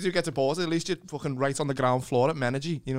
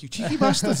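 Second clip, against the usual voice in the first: higher, cheeky scolding jab; lightly played shop worker, teasing; mock till cashier; 7 semitones higher, 5 dB louder than usual. Host plays a cashier scolding cheeky shoppers. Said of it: "you're like".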